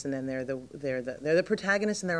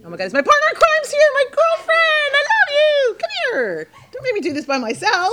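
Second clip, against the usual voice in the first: much higher and louder, gushing with delight; real excited greeting; 13 dB louder than usual. Real, not acted. Greets girlfriend arriving mid-interview.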